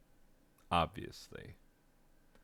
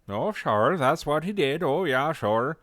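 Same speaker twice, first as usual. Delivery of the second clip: high, loud Irish cop caricature; cartoon Irish cop; 9 semitones higher, 6 dB louder than usual. Mocking old cartoon Irish cops, loud high Irish accent. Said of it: "pretend that it's like a Bugs Bunny".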